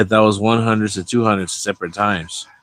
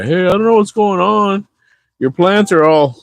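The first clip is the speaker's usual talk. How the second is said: high-pitched, louder worried dad; 11 semitones higher, 5 dB louder than usual. Retold or imitated. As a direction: play the fretting father, pitched up. Dad voiced on the phone.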